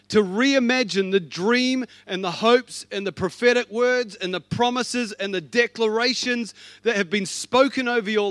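loud, rising, passionate sermon delivery; full force preaching. Acted. Preacher urging the congregation to reimagine their faith, full force preaching. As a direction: build it up like a revival call.

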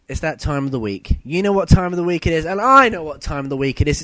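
ordinary delivery

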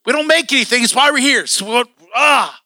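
high, loud exasperated rant; worked-up retelling of an angry outburst; frustrated. Retold or imitated. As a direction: rant until it breaks into a growl of frustration. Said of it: "I would get so frustrated".

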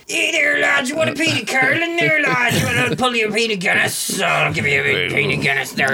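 hammed-up rough Irish accent; Irish docker. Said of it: "sounded like a docker".

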